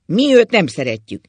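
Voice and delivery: loud, emphatic declaration starting high; shouting. A narrator's reading. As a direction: declare it loudly and forcefully. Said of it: "kiabált Ákos".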